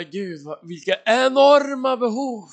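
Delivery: rising, drawn-out outburst of dismay; overwhelmed exclamation. Retold or imitated. Preacher recalling his reaction to huge needs, overwhelmed. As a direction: exclaim it high and slow.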